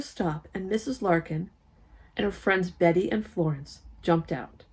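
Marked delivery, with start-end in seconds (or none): none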